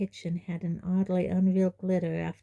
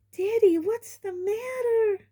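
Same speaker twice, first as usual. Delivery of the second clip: very high, slow anxious mother; mother alarmed at her son; Aunt Petunia alarmed; 3 dB louder than usual. A narrator's reading. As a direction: stretch it out in alarm.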